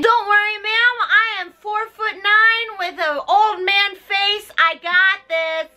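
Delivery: high, slow mock-heroic boast; high heroic nutcracker. Nutcracker reassuring Clara, in a high mock-heroic voice. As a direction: play the tiny hero, proud and slow.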